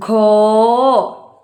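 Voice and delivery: ഘോ stretched very long; a teacher drawing out ഘോ; slow model answer. Shown as a demonstration. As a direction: hold ഘോ out for the class. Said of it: "എന്താ വായിക്കുക?".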